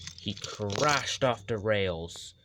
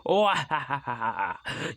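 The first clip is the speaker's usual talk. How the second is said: mocking laugh; 5 semitones higher, 4 dB louder than usual. Gordon laughing at Flynn, scornful laugh. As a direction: laugh down at the little fire engine. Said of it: "But Gordon just laughed".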